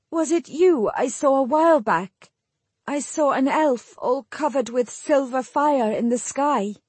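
high, wondering character voice; wondering Jill. A narrator's reading.

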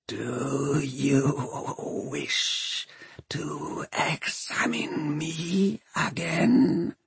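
slow, whispery undead machine; horrible voice. A narrator's reading. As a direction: whisper it haltingly, word by word. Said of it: "It is a horrible voice".

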